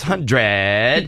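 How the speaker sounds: "hundred" very slowly drawn out, low; Laura's "hundred". Retold or imitated. Host imitating Jeopardy contestant, "hundred" drawn out long. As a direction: stretch "hundred" long on its last syllable, mimicking her. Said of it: "as opposed to Laura, where it's hundred".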